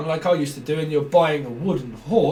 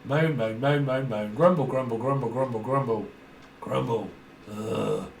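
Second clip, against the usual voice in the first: mock grumbling; 5 semitones lower, 4 dB quieter than usual. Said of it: "mocking moaning".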